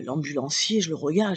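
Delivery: ordinary talk, nothing marked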